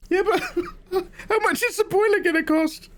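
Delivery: very high, tearful wail; mock crying. Householder asking gas man the price, mock sobbing. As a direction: whimper the question in a squeaky sob.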